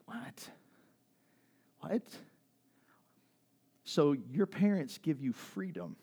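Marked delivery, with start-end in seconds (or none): 0.1-2.2 high, hushed "what"; breathy, shocked whisper of retold surprise; stunned whisper. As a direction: whisper it in disbelief. Stunned reaction to kid's confession.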